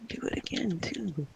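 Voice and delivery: rough whispered croak; raspy whisper. Real, not acted. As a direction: croak it out hoarsely. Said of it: "you know who you sound like when you speak like that".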